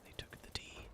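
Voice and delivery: hushed dramatic whisper. Pretend. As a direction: breathe it out with no voice. Dramatic reaction to losing point.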